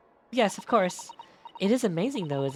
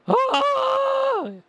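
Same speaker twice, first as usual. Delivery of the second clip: much higher, louder yelp that cracks; mock scream with crack; 8 dB louder than usual. Streamer corrects himself and nails the dog's cracking scream. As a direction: shriek the "Oh!" until it cracks. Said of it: "Wait, he goes".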